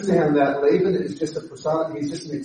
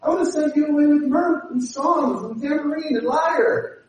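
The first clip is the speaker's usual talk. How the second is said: flattery; 9 semitones higher than usual. Retold or imitated. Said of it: "He uses flattery".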